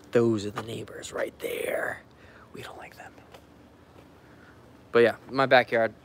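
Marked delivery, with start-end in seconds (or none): 0.1-3.1 whispered, secretive aside. Real, not acted. Filming neighbors, whispering a joke to viewers. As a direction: hush it so the neighbors won't hear.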